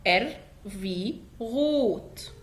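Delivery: ار في غوت said very slowly with a guttural ar; slow demo. Shown as a demonstration. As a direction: stretch ار في غوت to show the throaty r. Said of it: "اقرأ معايا". (نطق ار في غوت ببطء شديد)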